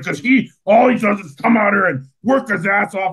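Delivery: put-on gruff old wrestling coach, high and loud; gravelly coach voice. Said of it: "he had a real gravelly voice".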